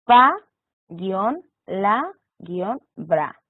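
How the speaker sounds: pa, la, bra said apart, each syllable set off with a pause; syllable by syllable, teacher demo; spelled out by syllables. Shown as a demonstration. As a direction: break the word into slow separate syllables. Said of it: "y las separamos a través del guión".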